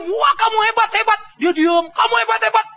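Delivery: high, eager praise; admiring praise. Retold or imitated. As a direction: gush over the hero. Soldiers praise the hero.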